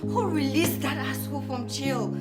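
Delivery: angry outburst. Acted. Cursing over a man freed from jail, angry outburst. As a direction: demand it in angry disbelief.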